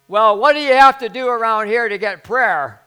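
high, very loud indignant yell; imitated bawling out. Lady yelling at the passing pastor, mock indignant bawling. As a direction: holler the complaint, hammed-up. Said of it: "bawling me out".

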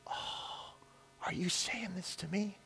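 barely audible, wondering whisper; hushed awe. Acted. Preacher asking God on prayer walk, awed hush. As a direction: breathe the question out in amazement. Awed reply to God's answer.

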